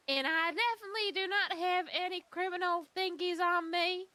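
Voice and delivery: high-pitched, drawling American accent; attempted American accent. Shown as a demonstration. Humble potato farmer protesting innocence, put-on American accent. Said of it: "when I try to do, uh, an American accent".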